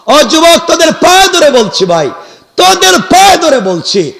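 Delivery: high, loud, urgent entreaty; impassioned pleading. Real, not acted. Preacher begging young men at their feet, impassioned plea. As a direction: beg them at full voice.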